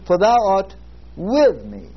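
"with" set off by a pause and punched; stress on with. Shown as a demonstration. Preacher demonstrating word-by-word reading, stress on "with". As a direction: pause after "art", then lean hard on "with". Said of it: "And this time you say".

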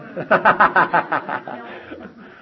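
loud rapid run of chuckles; real hearty laugh. Real, not acted. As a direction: burst out laughing at your own tease. Laughs at his own teasing.

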